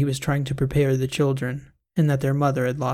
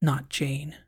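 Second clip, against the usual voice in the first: under her breath; 6 dB quieter than usual. A narrator's reading. Patient correcting the doctor's name, under her breath. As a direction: mutter it so the doctor barely hears. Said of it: "said under her breath".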